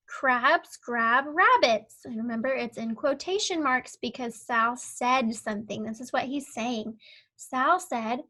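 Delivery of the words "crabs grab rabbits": slow, deliberate word-by-word; slow read-along. Shown as a demonstration. Teacher reading along with the pupil, slow and clear. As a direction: read it slowly so the child can follow. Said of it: "it says".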